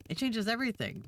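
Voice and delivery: high zealous cry of revelation; zealous exclaim. Acted. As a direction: sell it like a fanatic. Brainwashed vendor exclaims.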